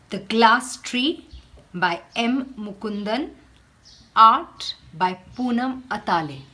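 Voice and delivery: measured read-aloud for a class; teacher reading a picture book aloud; storybook reading. Acted. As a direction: tell the tale to children, unhurried.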